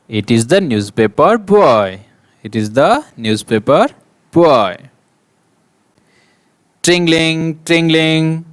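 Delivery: loud, lively classroom read-aloud; teacher reading a picture story aloud to young pupils; reading to children. Acted. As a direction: project the story to the whole class.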